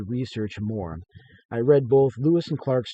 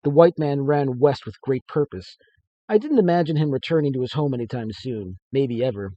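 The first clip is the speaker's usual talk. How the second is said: lively read-aloud narration, a bit louder; story narration; 3 semitones higher, 8 dB louder than usual. A narrator's reading. Author reading his story to listeners, lively narration. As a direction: read the tale with energy.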